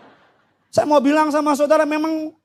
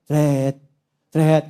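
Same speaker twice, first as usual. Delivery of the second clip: low drawn-out rattle "tet"; imitated fan noise; 12 semitones lower than usual. Father mimics child's hand in fan, low noise. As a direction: imitate fan blades catching fingers.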